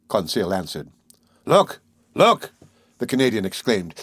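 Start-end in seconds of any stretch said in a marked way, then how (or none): 1.5-2.5 high, loud excited exclamations; full of excitement. A narrator's reading. Harpooner spotting the whale coming, full of excitement. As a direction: cry out at a thrilling sighting. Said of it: "his voice full of excitement".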